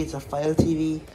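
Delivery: ordinary delivery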